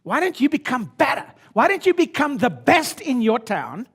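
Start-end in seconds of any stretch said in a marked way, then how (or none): none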